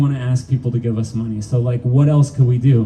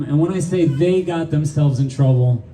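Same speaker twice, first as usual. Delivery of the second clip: usual speaking voice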